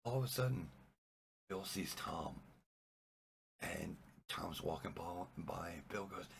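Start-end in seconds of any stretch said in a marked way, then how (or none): none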